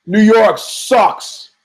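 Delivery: drawn-out taunting shout; retold scream. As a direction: scream it at the crowd. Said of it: "one guy from Ohio screams".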